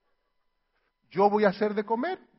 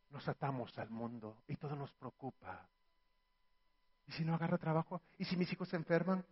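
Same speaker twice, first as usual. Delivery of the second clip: anxious worried murmur; 7 semitones lower, 15 dB quieter than usual. Acted.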